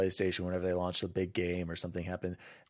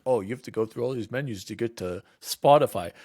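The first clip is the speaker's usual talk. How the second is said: mocking reviewer; 5 dB louder than usual. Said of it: "came down hard, like".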